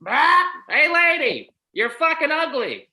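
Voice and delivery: high, loud squawking parrot voice; rude parrot. Acted. Comic telling a street joke, loud high parrot squawk. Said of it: "the parrot goes".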